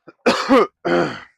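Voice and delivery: loud, high throat clear. Real, not acted. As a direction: hack it out hard. Still clearing bug from throat.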